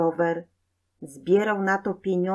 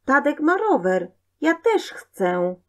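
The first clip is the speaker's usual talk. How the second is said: put-on high, louder child's demand; whining child; 8 semitones higher, 4 dB louder than usual. A narrator's reading. Boy demands a bicycle from mother, high and whiny. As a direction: insist like an envious little boy.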